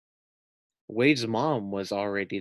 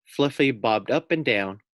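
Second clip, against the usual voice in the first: plain reading voice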